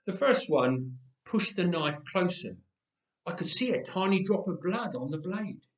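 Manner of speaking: plain reading voice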